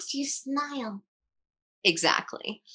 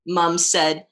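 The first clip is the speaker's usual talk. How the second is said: plain reading voice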